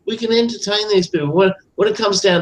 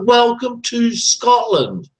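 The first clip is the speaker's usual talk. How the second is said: slow, deliberate, higher sign reading; sign read slowly; 5 semitones higher, slower than usual. Retold or imitated. Proving the Scot wrong, slow pointed sign reading. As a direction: spell out the sign word by word.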